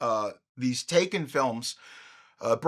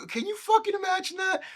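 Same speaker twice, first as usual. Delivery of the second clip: normal talking voice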